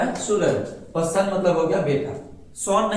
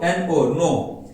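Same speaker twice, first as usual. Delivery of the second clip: normal talking voice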